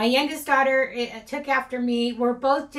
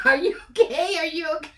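higher-pitched question, giggles breaking out; imitating laughing concern; 4 semitones higher, faster than usual. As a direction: act out checking on someone while trying not to laugh. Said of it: "I'm laughing while I'm saying".

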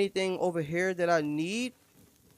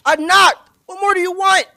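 loud frustrated complaint; exasperated shout; 11 semitones higher, 9 dB louder than usual. Real, not acted. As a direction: complain loudly at the game. Frustrated with the game.

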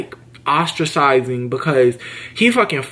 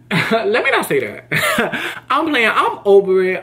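real laughter breaking in, higher pitched; laughing it off; 6 semitones higher, slower than usual. Real, not acted. Vlogger takes back a threat to a former coworker, laughing. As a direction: laugh through the words as you back off.

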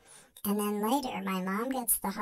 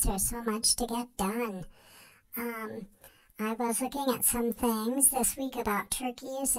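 usual speaking voice

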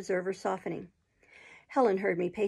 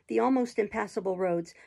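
usual speaking voice

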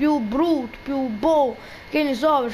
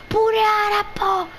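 playful exclamation; 7 semitones higher, slower than usual. Pretend. Chants a silly game word.

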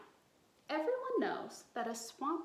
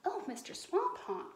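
cried out; 6 semitones higher than usual. A narrator's reading. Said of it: "cried Liza Lou".

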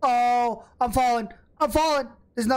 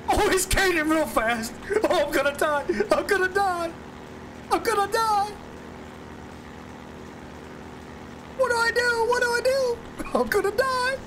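rising panic, pitch climbing higher; being chased; 8 semitones higher than usual. Real, not acted. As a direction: panic more with each line.

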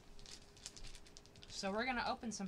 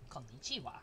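put-on Japanese accent; 8 semitones lower, 5 dB quieter than usual. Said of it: "I have a Japanese".

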